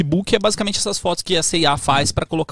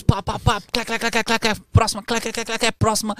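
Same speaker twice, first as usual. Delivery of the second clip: quick, higher clicking noises; camera click sounds; 8 semitones higher than usual. Retold or imitated. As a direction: rattle off shutter clicks. Imitating fast camera shutter.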